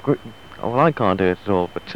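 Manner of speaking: ordinary delivery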